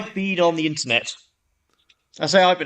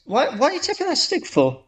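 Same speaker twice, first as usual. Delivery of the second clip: curious man; 9 semitones higher than usual. Acted. Man asks in joke.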